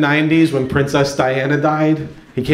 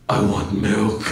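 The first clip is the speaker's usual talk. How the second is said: put-on deep baby voice; 6 semitones lower, slower than usual. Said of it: "He had this deep voice".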